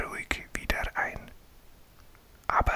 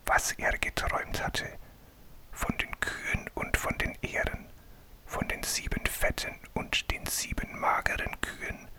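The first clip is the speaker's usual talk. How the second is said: whispered storytelling; 9 semitones higher than usual. A narrator's reading. Reading a bedtime Bible story to a sleepy child, whispered. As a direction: breathe the story out like a bedtime tale.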